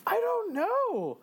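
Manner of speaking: high-pitched mock bewilderment; incredulous. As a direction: squeal it in hyped disbelief. Hyped, incredulous reaction to game boss.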